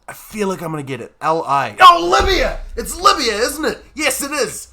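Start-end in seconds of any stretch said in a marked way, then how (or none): 1.8-4.7 high, loud burst of sudden recall; real triumphant excitement. Real, not acted. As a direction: cry out as the answer finally comes. Finally remembers the answer.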